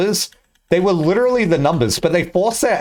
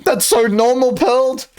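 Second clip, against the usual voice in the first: much higher put-on voice; higher, hammed-up rapper impression; 8 semitones higher than usual. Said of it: "He's like".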